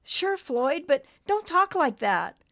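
high, pleading little voice; worried and begging; worried small voice. Acted. Nimbus worried.

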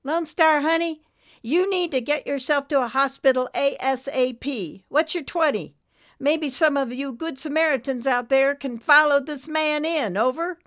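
high, loud, take-charge nurse voice; urgent radio nurse. Acted. Nurse sends a sick trucker to hospital, high and urgent. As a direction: project it up high over the CB.

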